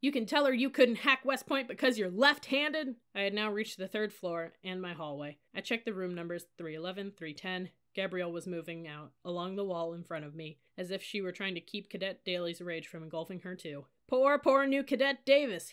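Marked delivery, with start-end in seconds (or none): 0.0-3.0 loud, high jeering; hectoring drill-sergeant ridicule; scornful taunt. A narrator's reading. Cadet officer taunting her. 14.1-15.7 high, loud fake pity; exaggerated jeering sympathy; mocking whine. A narrator's reading. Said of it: "he whined in a mocking voice".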